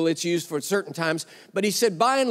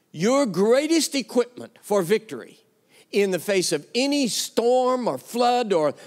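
ordinary delivery

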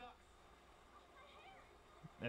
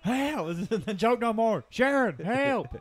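high loud frantic cries for help; panicked yelling; 12 semitones higher, 11 dB louder, slower than usual. Retold or imitated. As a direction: yell for Sharon in panic. Mock panicked call for help.

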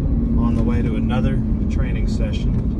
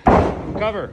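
boxing coach calling combos; 9 semitones higher than usual. Real, not acted. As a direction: bark out each move to the trainee. Coach calling wrestling drills.

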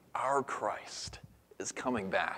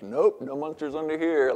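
higher, louder mock-dad announcement; breezy reassuring dad; 7 semitones higher, 7 dB louder than usual. Dad with flashlight checking under the bed, breezily reassuring. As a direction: sound the all-clear, high and loud.

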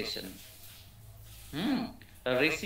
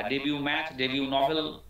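ordinary talk, nothing marked

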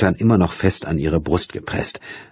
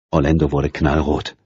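ordinary talk, nothing marked

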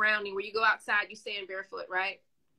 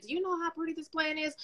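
gushing over a plant; 5 semitones higher, 5 dB quieter, faster than usual. Retold or imitated. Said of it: "I'm like".

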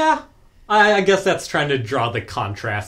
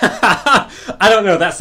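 laughing; 5 semitones higher, 6 dB louder, slower than usual. Real, not acted. Laughing at silly passage.

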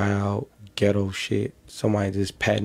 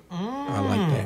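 high mock approval; 14 semitones higher, slower than usual. Pleased mock voice.

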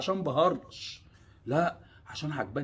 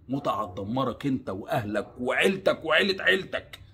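ordinary talk

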